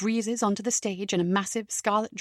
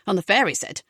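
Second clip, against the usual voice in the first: usual speaking voice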